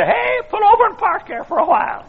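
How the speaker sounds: put-on very high old-man holler; squeaky old voice. Old man interrupting the preacher from his rocker, squeaky. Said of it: "In a squeaky old voice".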